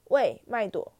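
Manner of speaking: loud mocking call across the room; 大声喊叫; shouting. A narrator's reading. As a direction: yell her name to tattle. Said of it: "大声吼道".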